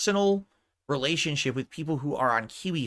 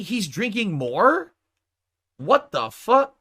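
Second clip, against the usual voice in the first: slow incredulous; 5 semitones higher, 3 dB louder, much slower than usual. Real, not acted. Incredulous at clip.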